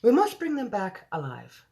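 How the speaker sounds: a hint of a royal English accent; Queen's English accent. The Queen insists to her generals, lightly English-accented. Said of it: "I have to do the Queen's voice".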